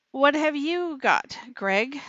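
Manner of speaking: ordinary talk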